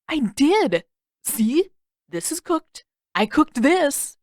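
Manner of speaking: proud big brother, slow on the last line; Sokka's voice. Acted. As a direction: show off the cooking, very pleased.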